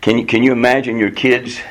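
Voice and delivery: ordinary talk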